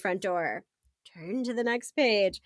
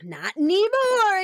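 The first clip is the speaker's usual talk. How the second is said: announcing; 7 semitones higher, 3 dB louder, slower than usual. Acted. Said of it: "you announce".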